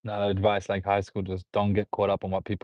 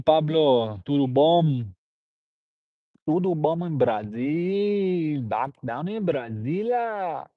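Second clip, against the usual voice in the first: put-on higher, singsong Brazilian accent with stretched vowels; 5 semitones higher, 3 dB louder than usual. Streamer greeting Pablo from Brazil, put-on Brazilian accent. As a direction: ham up a swooping, drawn-out Brazilian accent. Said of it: "Before I was doing accents".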